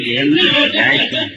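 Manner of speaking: amused reply to a joking stranger; laughing retort. Retold or imitated. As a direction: laugh while correcting him.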